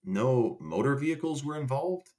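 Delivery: hint of a suspicious cop fishing for a charge; mock cop question. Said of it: "And they go".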